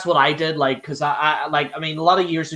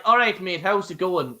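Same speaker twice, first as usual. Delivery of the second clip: a relaxed Irish accent greeting, nearly own voice; natural Irish demo; 3 semitones higher than usual. Shown as a demonstration. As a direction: say it the Irish way, easy. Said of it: "Irish is like".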